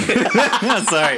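giggling sorry; high laughing apology. Real, not acted. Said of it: "I fucking hate U two".

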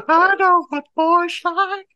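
very high, slow falsetto; squeaky mock voice, drawn out; high silly voice. Mock voice joke.

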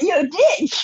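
high-pitched playful jab; mock insult on the edge of laughter; joking tone. Actress ribbing her co-star after the scene, joking tone. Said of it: "in a very joking tone".

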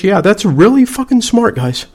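high-pitched mock approval; sarcastic. Real, not acted. Veteran mocking fakers who demand his papers, sarcastic.

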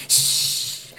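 sharp drawn "shh"; imitated shushing. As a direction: mimic someone hushing you. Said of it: "And he was like".

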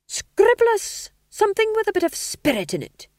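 a pushy manager barking orders at the writer; theatre manager voice. A narrator's reading. Theatre manager ordering a new scene.

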